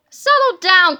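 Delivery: loud call to calm down; exasperated cry. A narrator's reading. Queasy boy reins in his dancing friend, crying out loudly. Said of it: "cried Harold".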